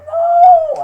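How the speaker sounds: high, loud shriek of protest. Real, not acted. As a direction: shriek no at mom. Protesting to mom.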